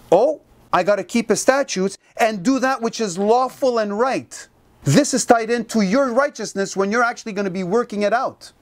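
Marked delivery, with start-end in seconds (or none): none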